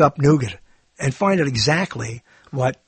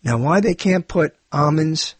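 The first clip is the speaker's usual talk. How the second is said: usual speaking voice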